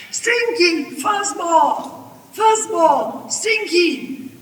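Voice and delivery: high, loud summoning yells; yelling. A narrator's reading. Grandma calling her animals from the tree, yelling. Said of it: "And she yelled".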